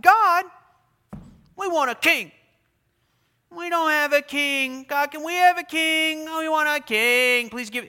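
put-on high, loud childish begging for a king; whining plea. Israel whining to God for a king, begging. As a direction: whine at God like a spoiled child. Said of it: "they started whining and complaining".

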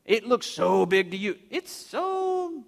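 condescending, rising to a high coo; teasing high voice on the smallness; mocking God. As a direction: belittle it with mock tenderness. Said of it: "see God mocking them".

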